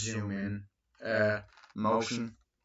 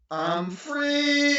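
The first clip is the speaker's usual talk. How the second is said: mock joyful cry; 5 dB louder than usual. Son excited after test.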